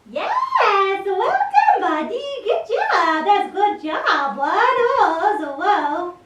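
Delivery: high, bright praise for a good dog; excited dog praise. Real, not acted. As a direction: cheer the puppy on, way up high.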